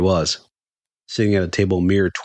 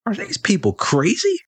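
high, drawn-out disbelief; incredulous; slower than usual. A narrator's reading. Fan marvelling at the empty autograph table, incredulous. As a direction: wonder aloud, slow and pitched high.

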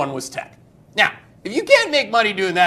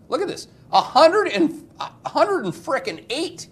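amazed; 11 semitones higher, 4 dB louder than usual. Real, not acted. Excited about chart number.

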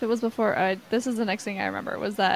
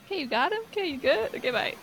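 high-pitched, brisk checking-in questions; mock-chirpy, eager to be gone; hasty sign-off; 7 semitones higher, faster than usual. Heroine handing him to his brother, high and hastily bowing out.

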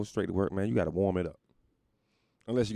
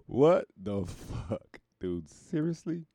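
slow disbelief; 4 semitones higher, much slower than usual. Acted. Disbelief at score.

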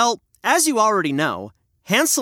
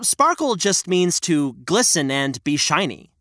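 ordinary delivery

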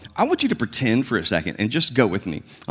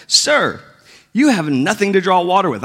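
higher, pointed objection; skeptical woman; 6 semitones higher than usual. Retold or imitated. Woman objects that Jesus has no bucket, higher and skeptical. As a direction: point out the flaw, pitched up.

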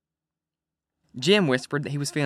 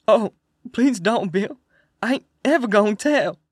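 much higher, louder terrified plea, slowed; begging; 7 semitones higher, 4 dB louder than usual. A narrator's reading. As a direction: beg for your life in panic. Tied man begging for life.

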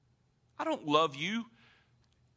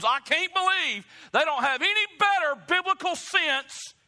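prideful sneer, building to higher and louder; self-righteous scorn; 8 semitones higher, 5 dB louder than usual. Retold or imitated. Preacher mocking his own pride at youth, scornful. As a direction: scoff at the young ones from on high. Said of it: "self-righteous, prideful spirit and attitude".

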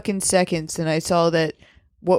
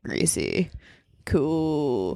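much lower flat voice; deep deadpan; 6 semitones lower, 6 dB quieter, much slower than usual. Retold or imitated. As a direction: drop into a bored low drone. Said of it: "it was like".

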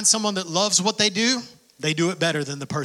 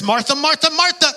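calling out Martha; 9 semitones higher, 4 dB louder than usual. Pretend.

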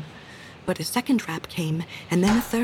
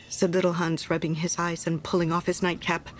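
ordinary delivery

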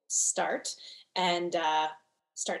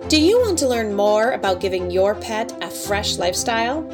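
ad read; 6 dB louder than usual. Acted. Podcast outro read over music.

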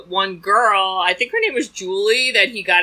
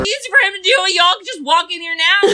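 high, quick goofy voice; hammed-up airhead store clerk; dopey clerk voice; 10 semitones higher than usual. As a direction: play the clueless clerk, pitched up. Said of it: "And I'm like".

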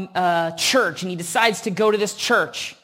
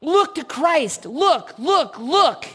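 preacher calling out; 6 semitones higher than usual. Retold or imitated. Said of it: "He says".

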